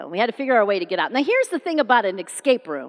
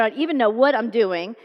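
normal talking voice

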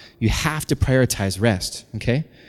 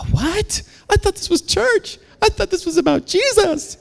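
very high, squeaky mock protest; hammed-up naive complainer; shocked churchgoer. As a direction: whine it high in disbelief. Mimics a shocked churchgoer objecting.

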